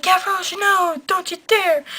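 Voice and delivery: mock pleading shout. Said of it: "the barricade guys are like".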